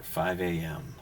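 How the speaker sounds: usual speaking voice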